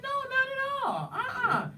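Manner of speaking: very high, drawn-out fake innocence; hammed-up high-pitched "who, me?" protest; mock denial. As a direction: deny it in an exaggerated high squeal. Comic denial to a suspicious heckler.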